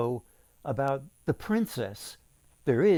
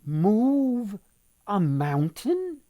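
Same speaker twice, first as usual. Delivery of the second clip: higher, louder astonished cry; the crab can't believe the task; shocked crab repeats the king's demand; crab's disbelief; 7 semitones higher, 5 dB louder, much slower than usual. Acted.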